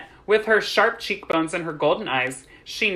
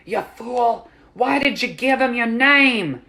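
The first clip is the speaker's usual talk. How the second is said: higher, exasperated self-reproach; self-scolding; 8 semitones higher, 4 dB louder, slower than usual. A narrator's reading. Girl scolds herself for giving her name, exasperated. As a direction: scold yourself for a stupid slip.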